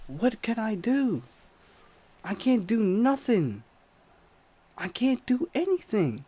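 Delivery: high, loud, panicked cry for help; panicked yelling. Retold or imitated. Narrator panicking at the attacker, high panicked yell. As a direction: shout it like you're helpless and scared. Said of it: "I'm like".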